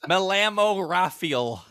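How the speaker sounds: high, very slow, hammed-up American-accented Spanish. Cruz overdoing his disguise in Mexico, exaggerated American-accented Spanish. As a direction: stretch the Spanish out in a thick gringo accent, half laughing.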